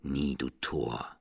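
quiet low raven croak. A narrator's reading. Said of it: "mit dem Krächzen".

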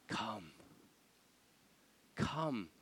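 soft, drawn-in invitation; appealing. Acted. Envoy appealing to the city, softly coaxing. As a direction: coax quietly, leaving space after the word. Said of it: "He appeals".

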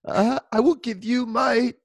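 high, slow put-on accent, drawn out; high fake posh accent for his wife's reply. Husband to listener, high slow fake accent. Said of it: "I don't know why she has an accent".

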